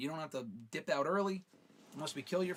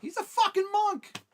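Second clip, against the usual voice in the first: much higher, much louder, slow disbelief; outraged shout; 11 dB louder than usual. Real, not acted. Shouting at a viewer's suggestion, outraged disbelief. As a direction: howl it in mock outrage.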